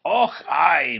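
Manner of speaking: drowsy Scottish mutter; sleepy Scots engine grumbling; sleepy Scottish accent. Acted. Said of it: "muttered Donald sleepily".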